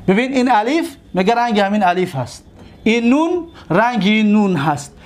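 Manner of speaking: ordinary talk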